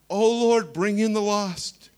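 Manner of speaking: loud, slow, hammed-up intercession; imitating a fervent pre-service prayer, loud and drawn out; mock prayer meeting. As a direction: parody the pleading prayer leader. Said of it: "It's the one that says".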